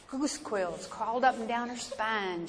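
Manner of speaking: usual speaking voice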